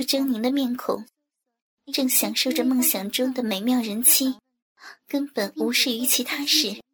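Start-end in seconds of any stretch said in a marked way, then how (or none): none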